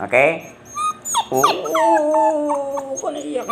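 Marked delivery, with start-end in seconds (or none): 1.3-3.5 long drawn-out, very high coo; soothing coo. Real, not acted. Comforting a crying pet left by its owner, cooing.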